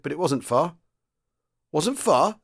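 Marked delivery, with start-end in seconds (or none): 1.7-2.4 high-pitched puzzled echo question. A narrator's reading. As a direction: echo WASN'T FAR back in puzzlement. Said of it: "The sergeant major seemed puzzled".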